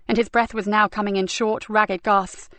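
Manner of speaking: ordinary delivery, nothing marked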